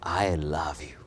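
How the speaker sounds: whispered tenderly, no voice. Shown as a demonstration. Preacher whispering love to a brother. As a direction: breathe it softly like a loving secret. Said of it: "Or you can say".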